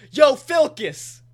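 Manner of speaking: loud, slow call across the room; mock bro shout. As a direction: hail him like a loud bro. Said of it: "he just goes".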